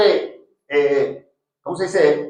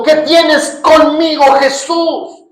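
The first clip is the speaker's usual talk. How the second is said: higher, louder, slow anguished cry; demon's voice; 7 semitones higher, 4 dB louder, slower than usual. Acted. The demon crying out to Jesus, high and anguished. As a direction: plead high and hard like the possessed man.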